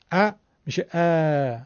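short ا, then ا drawn out long, same front 'a'; stretched fatha. Shown as a demonstration. Teacher showing fatha doubled never becomes alef, drawn-out front 'a'. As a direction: stretch the second ا without changing its vowel. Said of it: "اگر این فتحهٔ فارسیمون رو بکشیم".

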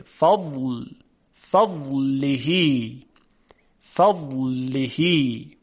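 فضلهی very slow, the ض pressed hard from the tongue's side; slow teaching demo of فضلهی; careful ض in فضلهی. Shown as a demonstration. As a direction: stretch فضلهی out, ض clearly sounded. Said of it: "ضاد باید به خوبی تلفظ بشه".

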